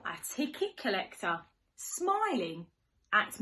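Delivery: sing-song storybook rhyme; reading to children. Acted. Teacher reading to little ones, sing-song and playful. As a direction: read it like a bedtime picture book. Reads rhyming picture book.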